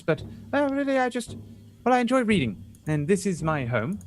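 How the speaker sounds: high, hesitant, genteel raccoon; monocled raccoon. Acted. Raccoon introduces himself to the visitors, high and hesitant. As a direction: introduce yourself haltingly, pitch lifted. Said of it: "the raccoon says".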